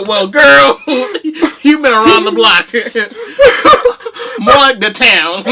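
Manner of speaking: loud, slow punchlines through laughter; laughing mock doctor. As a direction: drag out the words while cracking up. Plays doctor giving results, cracking up.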